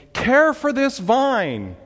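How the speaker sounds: loud, high, drawn-out plea; emphatic exclamation. Acted. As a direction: slowly cry out a command to God. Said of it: "Exclamation point".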